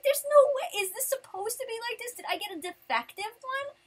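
high-pitched incredulous questioning; exasperated, high-voiced protest; upset. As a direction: reenact upset disbelief, voice way up. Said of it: "I was really upset".

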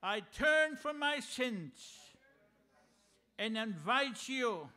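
solemn sinner's prayer led aloud; leading prayer. Acted.

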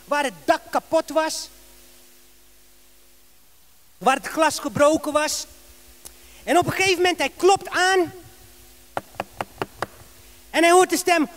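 ordinary talk, nothing marked

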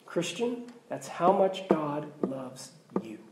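soft, slow, unvoiced whisper. Real, not acted. Pastor whispering to the church how loved they are, hushed. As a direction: lean in and whisper it to them.

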